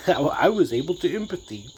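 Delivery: laughing retake of his own slip; laughing at own word. Shown as a demonstration. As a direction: repeat it through a laugh, amused at the mistake.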